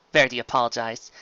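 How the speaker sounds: ordinary delivery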